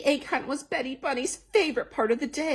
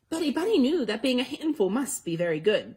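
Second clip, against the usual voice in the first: ordinary delivery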